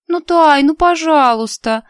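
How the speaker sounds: high, slow whining plea; whiny pleading. A narrator's reading. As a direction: draw it out like a whining child. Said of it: "законючила Лиара".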